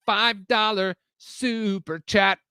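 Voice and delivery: slow, high announcer-like repeat; drawn-out hype. Pretend. Streamer hyping a viewer's donation, drawn-out hype. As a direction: stretch out each word of "Five dollar super chat".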